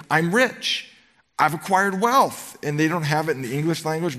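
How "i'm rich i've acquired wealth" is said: higher, slow self-satisfied boast; smug rich church. Retold or imitated. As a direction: gloat over your wealth, drawn out. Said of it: "now he's gonna quote them".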